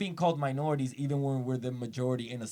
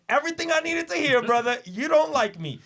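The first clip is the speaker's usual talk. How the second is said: loud, high mock outrage; hammed-up indignant comeback to a clerk; indignant retort; 11 semitones higher, 9 dB louder than usual. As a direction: call him out loudly, pitched way up. Acted retort to the sighing clerk.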